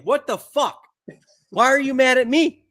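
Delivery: high, loud incredulous outcry; mock outrage. Car buyer vs recalls, mock outrage. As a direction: throw it up in disbelief.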